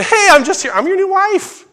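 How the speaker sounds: high, loud, brash announcement; hammed-up high-pitched proclaiming; mock proclamation. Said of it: "Certainly not a proclamation".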